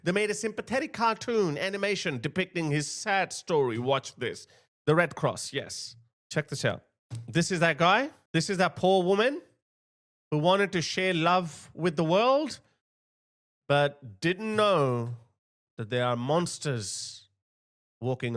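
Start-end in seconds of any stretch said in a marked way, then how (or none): none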